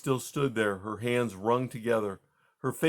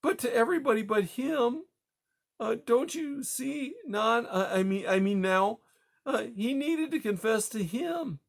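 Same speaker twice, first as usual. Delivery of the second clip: anguished; 12 semitones higher than usual. Acted. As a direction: stumble through it, desperate to be understood. Widow insisting through grief.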